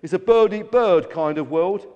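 loud, brazen self-defence; defiant and forceful, no apology; shouting. A narrator's reading. Greedy gannet justifying himself to gull, shouted. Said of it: "Grabby shouted".

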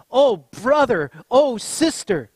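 loud, high, drawn-out reproach; imitating a booming, slow scolding; mock exasperated cry. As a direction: groan it out loudly, disappointed in them. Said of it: "he whispers and says".

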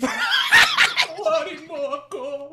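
high, loud wailing sob; hammed-up melodramatic crying plea; fake crying. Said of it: "a Filipino crying and just saying".

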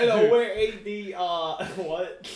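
put-on high, loud laughing Kiwi accent; New Zealand accent. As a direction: imitate a Kiwi, cracking up. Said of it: "what is his New Zealand accent?".

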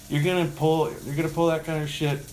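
mock incredulous reproach; retold indignant scolding. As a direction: confront a petty customer. Retold telling-off of the customer.

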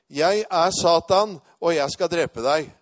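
laut, langsam und gedehnt gerufene Drohung; roping. Retold or imitated. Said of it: "Og så roper han".

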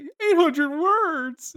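high, loud, drawn-out cartoon yell; hammed-up squeal of enthusiasm; excited SpongeBob. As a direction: shout it with over-the-top kid-like glee, stretching the words. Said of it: "he's like ... so excited for the essay".

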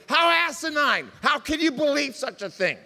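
high, loud and scornful; indignant outburst. Real, not acted. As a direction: call it out as nonsense, loud. Preacher mocking a worldview.

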